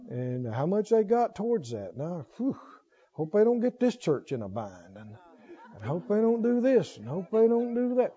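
a church gossip muttering doom; gloomy worrier. Retold or imitated. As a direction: sigh and fret like a head-shaking pessimist. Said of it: "Shake their heads".

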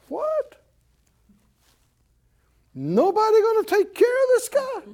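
high-pitched disbelieving outburst; incredulous. Acted. Young David hears the giant's tirade unanswered, incredulous. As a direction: squeak it out in disbelief.

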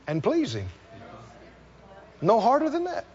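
ordinary talk

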